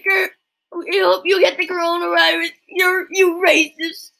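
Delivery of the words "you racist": ordinary talk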